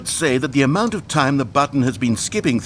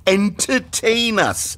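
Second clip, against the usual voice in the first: mock demand; 11 semitones higher, much slower than usual. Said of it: "gnashed their teeth and said".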